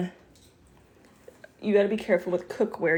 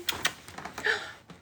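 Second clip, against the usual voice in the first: delighted high gasp; 4 dB quieter than usual. Real, not acted. Excited gasp at the bird.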